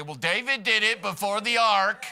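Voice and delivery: high, loud voice of a congregant objecting; eager objector. Retold or imitated. Pastor mimics a congregant raising a hand to object, high and loud. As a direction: pipe up like a know-it-all in the pews. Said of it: "somebody raise your hand and say".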